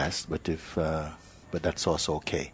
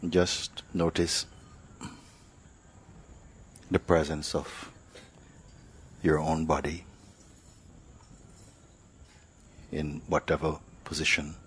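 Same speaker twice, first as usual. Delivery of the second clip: guided meditation; 3 dB quieter than usual. Acted. Teacher leads sitters to notice body and breath, unhurried meditative delivery. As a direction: guide them gently inward, leaving silence between phrases.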